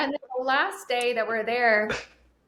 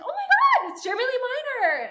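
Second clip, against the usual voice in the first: starstruck squeal. Retold or imitated. Spotting the sales trainer on video, starstruck squeal. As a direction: squeal his name like you've spotted a celebrity. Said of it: "And it sounds just like that".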